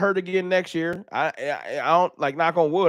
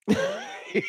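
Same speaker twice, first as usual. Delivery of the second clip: high giggle; 6 dB quieter than usual. Real, not acted. Laughs at idea of crying.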